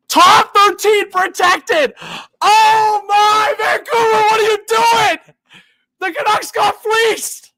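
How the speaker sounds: high, slow, shouted disbelief; shocked outburst. Real, not acted. A fan reacting to the trade, shouting in slow disbelief. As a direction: wail it out like your team just got robbed.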